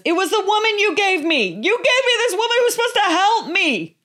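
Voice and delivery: blaming husband. Retold or imitated. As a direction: pass the blame in a panicky whine. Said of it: "He goes".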